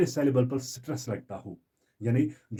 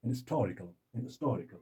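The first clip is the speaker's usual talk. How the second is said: "historical" softer, no H, weight on TOR; second-syllable stress; 2 semitones lower, 7 dB quieter, slower than usual. Shown as a demonstration. Teacher demonstrating stress for learners, "historical" stressed on TOR. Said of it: "जिस पर stress हो".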